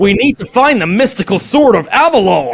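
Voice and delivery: loud, high, hammed-up fantasy hero in American; cheesy American accent. Mocking fantasy films with American voices, hammy American accent. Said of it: "When it's, like, an American accent".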